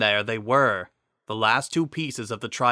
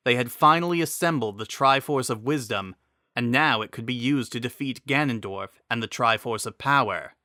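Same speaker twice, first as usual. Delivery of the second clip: usual speaking voice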